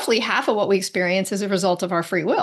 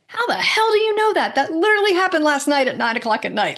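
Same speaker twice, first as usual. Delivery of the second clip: much higher, voice jumping up in shock; shocked disbelief; 6 semitones higher than usual. Retold or imitated. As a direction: blurt it out as if caught off guard. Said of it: "her eyes flew open and she goes".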